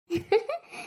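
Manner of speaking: short, high giggle; amused laugh. Acted. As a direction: giggle at your sister's predicament. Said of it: "உனக்கு சிரிப்பா இருக்கா?". (கேலியான சின்னச் சிரிப்பு)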